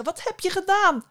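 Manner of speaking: high, loud outraged demand; alarmed cry, pitched right up; shocked shout. A narrator's reading. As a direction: shout it in startled accusation. Startled burnt god confronts Psyche.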